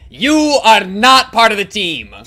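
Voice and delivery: loud, slow, stress on not; loud emphatic. Real, not acted. Rejecting the character loudly.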